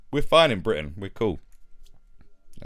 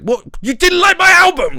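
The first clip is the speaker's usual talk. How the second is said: high, loud offended yell; mock outraged rapper; 12 dB louder than usual. Imitating [name] yelling at a journalist, high and loud. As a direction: blow up like a touchy artist.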